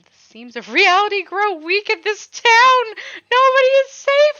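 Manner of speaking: high, loud eerie proclamation; ominous line. Acted. Player blurts creepy doom line, high and loud. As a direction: boom it out like a cursed oracle. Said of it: "What the fuck? God, who would say that?".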